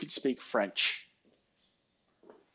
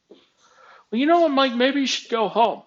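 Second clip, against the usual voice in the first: high-pitched, louder mock boss; imitated boss; 10 semitones higher, 4 dB louder than usual. Boss telling employee to go home, mock high voice. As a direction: send up the boss's suggestion in a raised voice.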